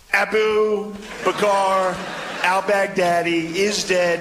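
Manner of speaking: slow, drawn-out Trump voice; Trump impression. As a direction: drag out each word like Trump. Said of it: "and just goes".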